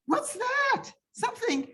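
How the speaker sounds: high squeals of disgust; disgusted shriek. Acted. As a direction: recoil with a high ew. Sally disgusted by dripping.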